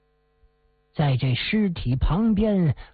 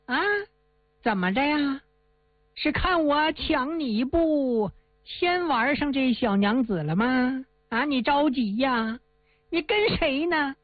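higher, jeering thug voice, sped up at the end; arrogant villain; 3 semitones higher than usual. Acted. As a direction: brag and threaten like a spoiled bully. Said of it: "一阵的奸笑啊". (恶霸仗势欺人地嘲弄朱元璋)

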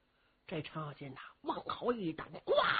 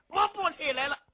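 sulky refusal; 8 semitones higher than usual. Acted. Cheng Yaojin refuses to rise.

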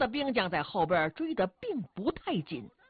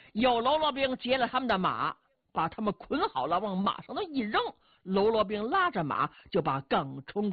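ordinary delivery, nothing marked

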